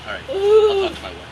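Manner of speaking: a customer in a hammed-up English accent; mock English accent. Haggling customer to shopkeeper, in a mock English accent. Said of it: "talk in English accent for both".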